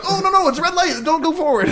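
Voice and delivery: high-pitched pleading with the car; mock panic. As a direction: beg it to stay put, hammed-up.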